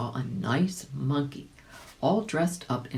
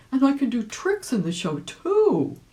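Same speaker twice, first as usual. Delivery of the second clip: high and louder, rising; eager; 8 semitones higher, 5 dB louder than usual. A narrator's reading. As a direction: eagerly offer more. Rabbit eager to join show.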